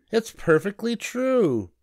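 high-pitched triumphant rabbit; cocky little rabbit voice; bragging rabbit. A narrator's reading. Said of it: "bragged the rabbit".

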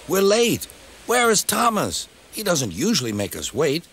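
high-pitched impatient grumble; impatient engine. A narrator's reading. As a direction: fret in a high voice about being kept waiting. Waiting engine complains of lateness.

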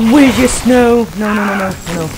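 high, loud panicked no's, fading out; panicked scream. Real, not acted. Player screaming as the monster grabs them, panicked. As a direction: yell no in real terror.

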